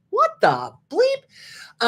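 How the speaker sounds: high, slow, stunned what the; incredulous outburst. Real, not acted. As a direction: drag it out in disbelief. Reacting to outrageous list item.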